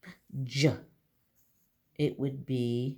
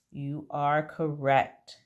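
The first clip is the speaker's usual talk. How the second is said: ordinary talk, nothing marked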